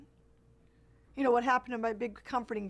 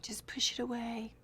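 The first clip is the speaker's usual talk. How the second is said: hushed, softer whisper; whispered self-soothing; 5 dB quieter than usual. Retold or imitated. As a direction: whisper it inwardly like a coping mantra. Inner voice repeats coping advice.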